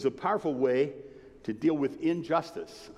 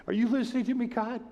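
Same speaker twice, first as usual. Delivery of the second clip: higher, desperate plea to God; pleading with God; 8 semitones higher, faster than usual. Retold or imitated. Preacher retelling his plea to God, desperate and high. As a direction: cry out to God, begging to be heard.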